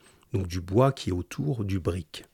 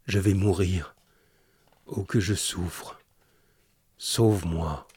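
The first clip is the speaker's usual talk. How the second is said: put-on faint, slow, broken murmur of a dying old sailor; softer, slower, a dying plea; dying man's murmur; 2 semitones lower, 4 dB quieter, slower than usual. A narrator's reading. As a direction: barely get the words out, in pain. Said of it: "Le vieux marin murmurait quelques mots sans suite".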